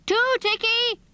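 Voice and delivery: high-pitched call to a friend. Acted. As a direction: call the name out to someone out of sight. Calling out to a friend.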